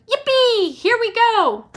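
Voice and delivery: very high, loud gleeful whoop; shouting yippee. A narrator's reading. Grandpa Joe on the plunging elevator, gleeful shout. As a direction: whoop on the drop. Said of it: "Grandpa Joe shouted".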